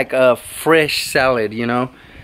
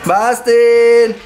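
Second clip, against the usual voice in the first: higher, long drawn-out name call; calling the dog; 5 semitones higher, 3 dB louder than usual. Real, not acted.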